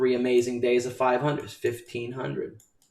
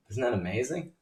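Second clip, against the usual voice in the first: ordinary delivery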